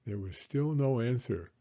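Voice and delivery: usual speaking voice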